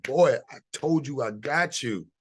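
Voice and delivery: a hammed-up divine voice giving firm orders; voice of God. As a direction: lift the voice high and speak as God telling him to stop. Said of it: "God said".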